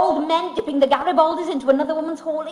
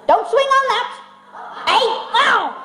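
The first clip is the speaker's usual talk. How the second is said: shouting instructor; 9 semitones higher than usual. Acted. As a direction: yell a warning across the gym. Hard of hearing gymnastics instructor.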